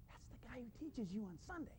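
very hushed imitation of a small boy; child confiding. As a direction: tell Dad a secret, barely audible. Small boy confides in his dad.

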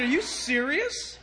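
slow, drawn-out question of disbelief; incredulous. Retold or imitated. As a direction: ask it in retold astonishment, rising at the end. Preacher retells his disbelieving question.

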